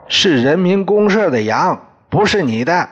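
loud, high-pitched angry scolding; angry outburst. Acted. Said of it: "我火了". (发火大声训斥)

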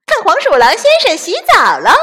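high, loud, fast taunting; gleeful taunt. Acted. Chick gleefully mocking the weasel's bath. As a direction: gloat with glee.

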